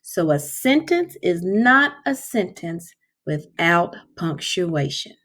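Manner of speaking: ordinary talk, nothing marked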